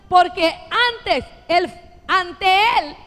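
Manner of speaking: loud, slow, fervent proclamation; preaching at full force. Acted. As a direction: drive the promise home to the church. Preaching the verse.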